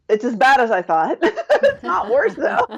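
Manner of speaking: laughing through the bad news, rising high; rueful laugh. Real, not acted. Woman laughing at her own debt total, rueful. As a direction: chuckle at how bad it is.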